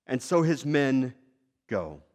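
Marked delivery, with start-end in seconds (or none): none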